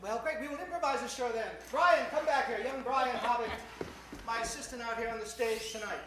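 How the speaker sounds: normal talking voice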